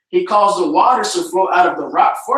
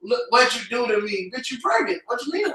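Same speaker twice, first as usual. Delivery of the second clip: movie quote voice; 3 semitones higher, 6 dB quieter than usual. Retold or imitated. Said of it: "in the movie, they always say".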